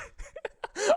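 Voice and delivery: sudden high burst of laughter. Real, not acted. Laughs at meme.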